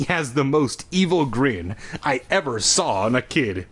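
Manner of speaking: ordinary delivery, nothing marked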